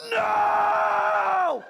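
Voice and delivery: long high, loud cry of horror; anguished scream. Acted. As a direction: scream it like the worst news of your life. Realizing the catheter, acted horror.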